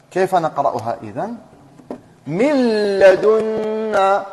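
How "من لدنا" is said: من لدنا with the nun merged into the lam, very slow and drawn out; slow tajweed demo. Shown as a demonstration. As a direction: stretch لدنا slowly like a Quran teacher. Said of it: "أقرأها؟". (قراءة مجودة بطيئة لمن لدنا)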